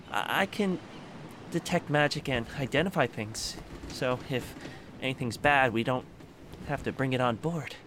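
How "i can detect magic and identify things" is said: ordinary talk, nothing marked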